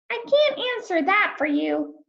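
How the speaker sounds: high, gentle starfish voice; a kindly sea creature explaining. Acted. Starfish answers lonely Rainbow fish, high and gentle.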